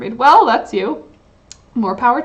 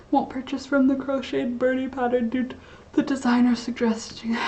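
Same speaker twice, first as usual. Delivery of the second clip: soft unvoiced whisper; 3 semitones higher, 6 dB quieter than usual. Real, not acted. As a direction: whisper the message under breath. Reading a chat message aloud.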